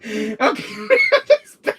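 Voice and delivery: very high, squeaky laughing speech. Real, not acted. Cracking up at the bad act, helpless laughter. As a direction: squeeze it out through laughter.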